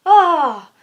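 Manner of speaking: short, loud frustrated "ah"; exasperated yell. Real, not acted. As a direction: let out a loud cry of frustration. Exasperated homework rant.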